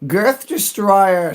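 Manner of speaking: slow, high, drawn-out call of the name; drawn-out greeting. Real, not acted. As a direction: stretch the name out like a big welcome. Greeting a chat regular.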